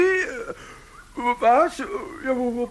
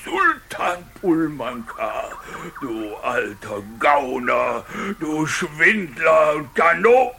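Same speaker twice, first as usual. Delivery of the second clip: ordinary talk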